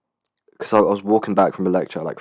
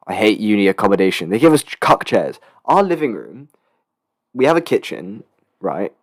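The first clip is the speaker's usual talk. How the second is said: ordinary delivery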